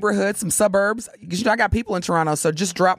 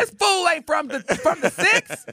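mocking Toronto local; 9 semitones higher than usual. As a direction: sneer at the outsider. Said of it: "They'd be like".

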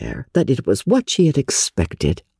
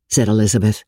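plain reading voice

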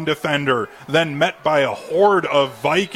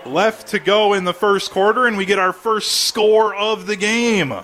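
high excited announcer voice; touchdown call; 6 semitones higher than usual. Real, not acted. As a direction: ride the thrill of the score, higher. Touchdown just scored.

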